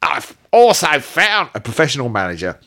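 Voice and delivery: lightly hammed Manchester accent for the memoir; mock Manchester accent. Shown as a demonstration. Host reading the poet's memoir in a Mancunian accent. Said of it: "better than your Australian accent".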